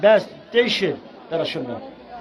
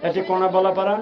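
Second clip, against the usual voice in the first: ordinary talk, nothing marked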